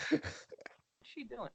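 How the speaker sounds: very soft, muttered question; hushed, puzzled aside; retold puzzlement. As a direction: ask it under your breath, baffled. Acts out own puzzled reaction.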